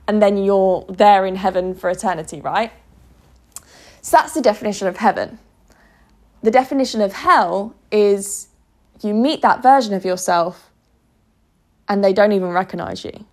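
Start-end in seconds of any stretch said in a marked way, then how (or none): none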